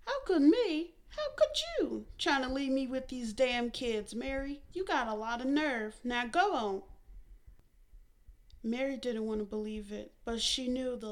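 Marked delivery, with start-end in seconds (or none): 0.1-6.8 much higher, louder mocking echo; mother mocking then scolding daughter. A narrator's reading. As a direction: throw the words back scornfully.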